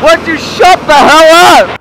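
loud angry yell; shouting. Real, not acted. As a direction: yell at them to shut up. Yelling at friends.

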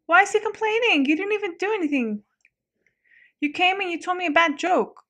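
indignant protest. Real, not acted. Shop owner answers a complaining customer, indignant.

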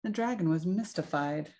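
ordinary delivery

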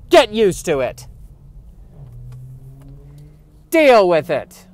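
loud, pushed mock yell; mock scolding shout. Telling Josh bicycles have right of way, mock shouting. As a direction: bark it like an indignant heckler.

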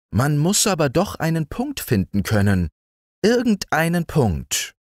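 high beetle voice; musing beetle. A narrator's reading. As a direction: insist wistfully as the beetle.

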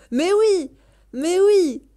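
loud, high swooping "mais oui"; excited approval. Real, not acted. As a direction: cheer it out twice. Excited reaction to the game. (approbation enthousiaste, voix haute et forte)

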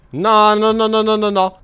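frantic, rising panic; panicked no. Real, not acted. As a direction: beg it away in panic. Fugge dal Warden. (no disperati in panico)

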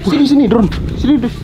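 high, loud calling out; urgent shout. Real, not acted. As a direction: demand it back loudly. Demanding drone back. (teriakan panik)

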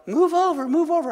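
high, urgent call to pass; mock-yelling at a runner ahead; retold yell. Daughter on the track yelling at dad to move over. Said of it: "she's yelling".

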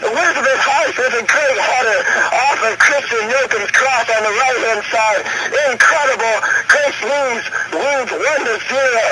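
high-pitched thrilled play-by-play; excited commentary. Real, not acted. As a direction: keep riding the goal high. Excited goal recap.

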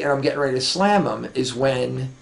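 ordinary delivery

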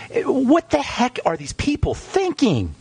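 host scoffing at doctors who prescribe Neurontin, high and slow; exasperated outburst. Real, not acted. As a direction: throw up your hands at the doctors, slow and incredulous.

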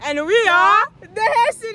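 high, loud hammed-up announcement; Gunther and Tinka. Friend redoing the siblings' intro from the top, hammed-up. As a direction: proclaim it like a showy sibling act. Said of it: "From the top".

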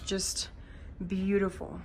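ordinary delivery

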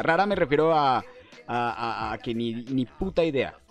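ordinary delivery

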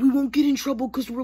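ordinary delivery

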